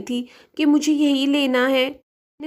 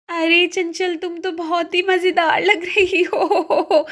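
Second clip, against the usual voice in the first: higher voice breaking into laughter; laughing; 5 semitones higher, slower than usual. Acted. Sister-in-law sees Chanchal in the huge coat, laughing as she speaks. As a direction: tease through rising giggles, drawing out the end. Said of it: "यहाँ तो मुझे ही इतनी हंसी आ रही है".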